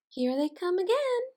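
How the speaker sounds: high, loud delighted call; excited exclaim. A narrator's reading. Teacher reading to children, excited high call. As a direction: announce the puppies with glee.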